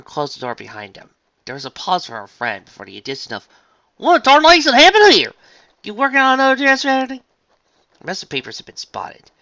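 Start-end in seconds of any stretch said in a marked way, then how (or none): 4.0-7.2 high, loud southern holler; calling out. Acted. Farmer calls to her friend from inside, calling out. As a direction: holler from the next room.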